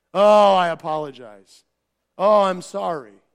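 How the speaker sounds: mock careless apology. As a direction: toss off a hollow apology. Mocking shallow cultural apology.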